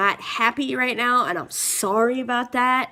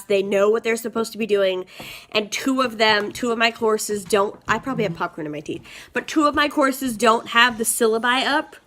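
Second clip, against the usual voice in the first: normal talking voice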